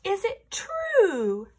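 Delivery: high, slow, sweet flattery; butter him up in a high voice; princess voice. Acted. Princess flattering the dragon, high and slow.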